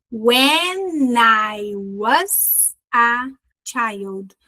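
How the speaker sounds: put-on "When I was a child" very slowly, word by word; slow breakdown. Shown as a demonstration. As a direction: stretch "When I was a child", pausing between chunks. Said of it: "Viu as junções?".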